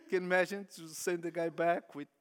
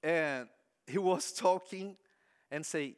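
ordinary talk, nothing marked

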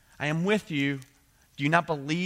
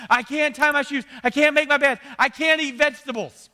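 put-on much higher and louder childish whine; whiny kid; 10 semitones higher, 9 dB louder than usual. Pastor imitates kids complaining they can't, loud whine. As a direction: wail it like a stubborn child. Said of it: "You hear this, "I can't".

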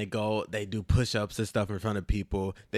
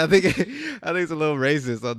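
laughing; 5 semitones higher, 9 dB louder than usual. Real, not acted. Giggling through a joke.

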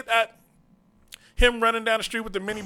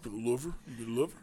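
Rocky impression; 8 semitones lower, 10 dB quieter, much faster than usual. Rocky line repeated.

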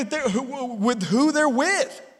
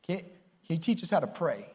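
lower, softer simple request; humble asking; 8 semitones lower, 10 dB quieter than usual. Retold or imitated. Disciples humbly asking Jesus to teach prayer. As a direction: ask humbly and gently. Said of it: "they said".

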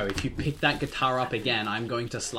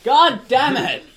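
high loud exasperated yell; frustrated shout; 11 semitones higher, 13 dB louder, slower than usual. Real, not acted. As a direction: blow up at the popping. Bubble wrap being popped.

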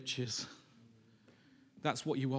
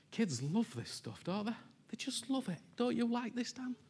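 playful, much higher sing-song cooing; 7 semitones higher than usual. Real, not acted. Preacher cooing at little Dan, playful high sing-song. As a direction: tease the little one fondly.

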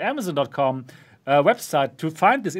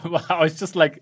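ordinary talk

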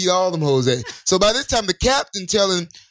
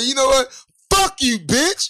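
mock angry yell; 6 semitones higher than usual. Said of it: "So he said".

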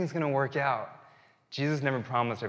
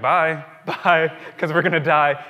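mock farewell cry; 3 semitones higher, 8 dB louder than usual. Children saying goodbye before danger.

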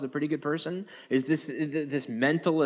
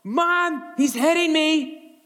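put-on much higher, slow tattling child yell; whiny kid; 5 dB louder, much slower than usual. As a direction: whine like a kid calling Mom from downstairs. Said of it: "and they go".

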